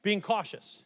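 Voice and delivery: loud, slow, fervent preaching; impassioned preaching. Real, not acted. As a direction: preach it with full fervour. Preaching at full force.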